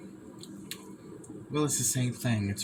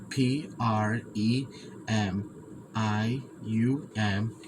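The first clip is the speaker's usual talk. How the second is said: spelling out slowly; much slower than usual. Shown as a demonstration. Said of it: "It's a hard word for me to say".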